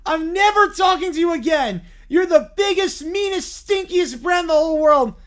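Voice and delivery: put-on a sulking kid about to lose it; upset whiny bear. Bear yells back.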